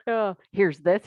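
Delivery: ordinary talk, nothing marked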